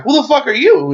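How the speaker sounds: high-pitched mock indignation; acting out an affronted demand; retold indignant challenge. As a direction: raise the pitch to imitate a startled challenge. Retold confrontation with a stranger.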